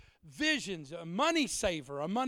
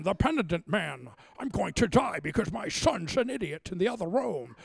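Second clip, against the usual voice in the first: a hint of a Scottish Connery accent, louder; lightly mock Connery in the pulpit; Sean Connery voice; 3 semitones lower, 4 dB louder than usual. Preachers imitating Sean Connery, a light Scottish Connery voice. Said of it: "Everybody turned into Sean Connery".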